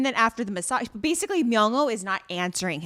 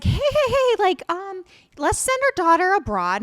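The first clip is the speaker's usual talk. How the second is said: high sweet wife voice; 8 semitones higher, 6 dB louder than usual. Retold or imitated. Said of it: "she's like".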